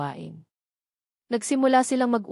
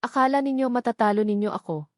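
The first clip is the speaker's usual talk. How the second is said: mild villain yell. Acted. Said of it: "sigaw ni Mayor Garcia".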